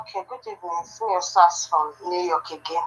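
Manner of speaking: caller heard through a phone speaker; thin, distant speakerphone sound. Real, not acted. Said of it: "let me put on speaker".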